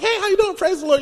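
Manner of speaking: churchy greeting. Retold or imitated. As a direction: greet everyone at the church door, overly sweet. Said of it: "I was like".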